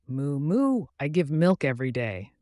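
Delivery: mild cow voice, gently mooing; gentle cow. Acted. Cow telling the meadow about milk, lightly voiced. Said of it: "the cow said gently".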